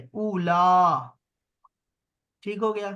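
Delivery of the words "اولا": اولا drawn out slowly and louder; loud slow demo. Shown as a demonstration. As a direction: call out اولا slowly for the class. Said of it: "جیسے یہاں میں نے کیا ہے". (اولا بلند اور آہستہ، کھینچ کر)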